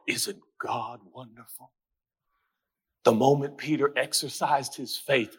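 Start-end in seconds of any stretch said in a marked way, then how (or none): 0.1-1.7 very soft, low, hushed admiration; hushed, low wonder; mock reverent awe. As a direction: murmur it like a devout reader. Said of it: "read this story and go".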